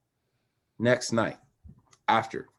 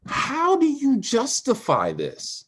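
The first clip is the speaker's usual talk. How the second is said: high, slow, incredulous demand; anguished question to Steve about the killing; outraged; 13 semitones higher, slower than usual. Real, not acted. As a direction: rise up in disbelief at the injustice. Outrage over George Floyd's killing.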